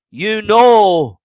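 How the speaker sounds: normal talking voice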